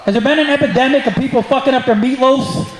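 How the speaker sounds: ordinary delivery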